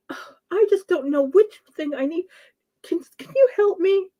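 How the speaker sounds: high, helpless plea put on for show; damsel in distress. Pretending to need a man's help at Lowe's, helpless damsel. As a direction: play up being lost and needing help. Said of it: "fall all over the damsel in distress".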